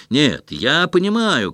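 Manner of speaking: third voice shouting. A narrator's reading. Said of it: "крикнул третий".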